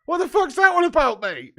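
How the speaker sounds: loud, high, outraged question; exasperated outburst. Real, not acted. Streamer mocks viewers' upside-down pies, loud and exasperated. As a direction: throw it at the chat in disbelief.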